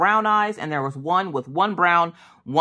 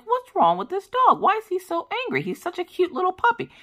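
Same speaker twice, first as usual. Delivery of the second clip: much higher, cooing over a grumpy puppy; cooing puzzlement. Retold or imitated. Meeting the angry-looking puppy at the breeder, high cooing. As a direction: coo at the puppy, baffled and charmed. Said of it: "And I was like".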